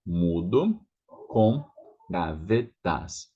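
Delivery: mudo com gavetas slowly; slow demo. Shown as a demonstration.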